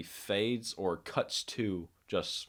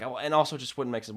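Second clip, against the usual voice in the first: ordinary talk, nothing marked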